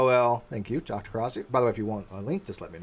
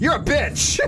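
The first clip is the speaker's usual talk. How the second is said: sudden high, louder snarl of an imitated creep; mock nasty text; 11 semitones higher, 4 dB louder, slower than usual. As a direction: flip from nice to vicious in one shot. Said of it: "And all of a sudden".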